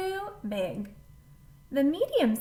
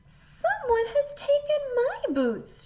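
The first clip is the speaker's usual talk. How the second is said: very high, louder little cub cry; high baby bear cry; 5 dB louder than usual. A narrator's reading. Baby bear can't find her boots, high cry. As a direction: cry out like a tiny upset cub. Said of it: "she cried".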